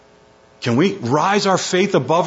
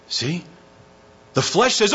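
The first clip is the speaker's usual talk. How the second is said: ordinary delivery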